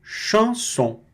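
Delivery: high, slow "chanson", nasal "chan". Shown as a demonstration. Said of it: "destacando a pronúncia do chan". (chanson com chan nasal)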